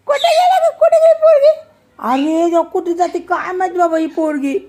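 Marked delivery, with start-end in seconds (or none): 0.1-1.5 high, loud shout; loud high-pitched calling. Real, not acted. Calls out after the girl.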